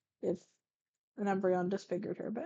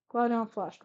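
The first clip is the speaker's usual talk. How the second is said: plain reading voice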